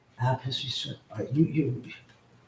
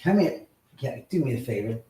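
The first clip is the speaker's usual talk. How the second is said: ordinary delivery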